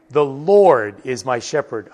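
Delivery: emphasize Lord above every other word; stress on Lord. Shown as a demonstration. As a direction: punch the word Lord, let the rest follow lightly. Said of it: "Emphasize the Lord".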